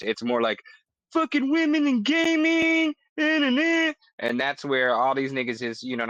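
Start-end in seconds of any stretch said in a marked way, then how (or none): 1.1-3.9 mocking whiny gamer. Mocking harsh gamer voices.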